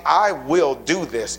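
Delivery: "I" stressed, each word slow and firm; slow certainty. Acted. Preacher voicing Jesus' promise to build his church, slow and firm. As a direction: say "I will do this" with unshakable resolve.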